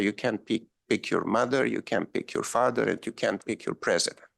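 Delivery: ordinary delivery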